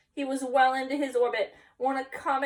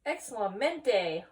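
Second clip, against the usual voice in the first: mild mock howl; much slower than usual. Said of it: "howled the doggies".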